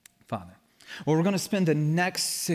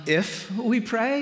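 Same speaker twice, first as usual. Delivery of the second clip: raised pitch, slow questioning rise; tentative, doubtful; 7 semitones higher, slower than usual. Shown as a demonstration. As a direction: float it as an iffy maybe. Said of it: "It's like, yeah, huh, maybe".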